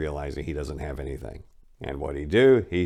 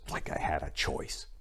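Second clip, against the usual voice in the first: whispered, much softer sarcastic aside; bitter aside; 4 semitones lower, 9 dB quieter than usual. Acted. Narrator muttering about the praise, whispered sarcasm. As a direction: breathe it out as a bitter little whisper.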